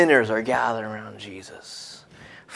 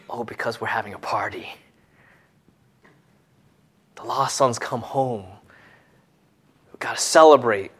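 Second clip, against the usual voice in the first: hushed aside; 4 semitones lower, 5 dB quieter than usual. Acted. Jesus' answer voiced as secret aside.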